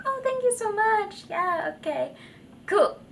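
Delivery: high, breathy-free polite thank-you voice. Retold or imitated. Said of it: "Most of it's just like".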